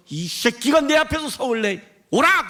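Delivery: high, drawn-out furious mock roar; angry thundering. Imagined God amid storm and lightning, mock angry thundering. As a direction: thunder a curse, hammed-up.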